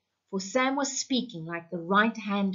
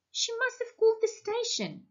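much higher, panicked man's voice; frightened husband; 14 semitones higher than usual. Acted. Husband realising his wife heard the broadcast, panicky and high. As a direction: squeak it out in alarm.

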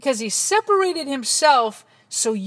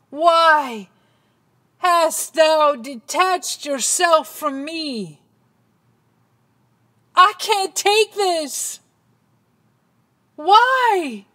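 raised, drawn-out pleading; anguished cry; 4 semitones higher, 4 dB louder, slower than usual. Acted. Jesus pleading with the Father who left him, anguished cry. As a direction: cry out slowly, rising high and loud.

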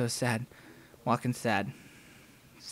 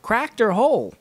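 higher, louder gruff question; shopkeeper voice; 7 semitones higher, 8 dB louder than usual. Retold or imitated. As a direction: bark it across the counter. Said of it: "he says".

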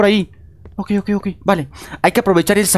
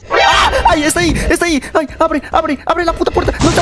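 panicked shouting; 10 semitones higher than usual. Real, not acted. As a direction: panic as the monster appears. Monster appears, panicking. (gritos frenéticos, agudos y fuertes)

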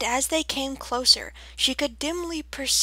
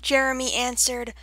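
ordinary delivery

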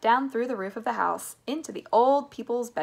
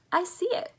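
much higher, sudden spotting; excited cry; 11 semitones higher than usual. A narrator's reading. Mister Wonka spots the cottage, excited cry. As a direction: pipe up high on spotting it. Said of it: "cried Mister Wonka".

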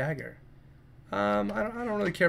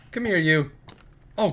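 ordinary talk, nothing marked

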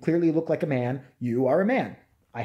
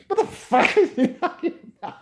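high shriek breaking into laughter; high laughing repeat; laughing disbelief; 12 semitones higher, 4 dB louder than usual. Real, not acted.

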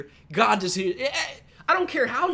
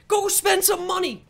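loud, slow demand, higher; shout; 8 semitones higher, 4 dB louder, slower than usual. Real, not acted. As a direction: yell it at the owners. Ranting demand at team.

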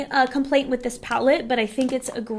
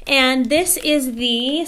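normal talking voice

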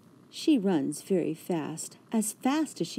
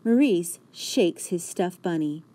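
ordinary delivery

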